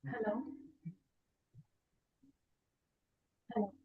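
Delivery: faint, far from the mic; speaking too softly to hear; barely audible. Real, not acted. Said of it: "Your voice is very low".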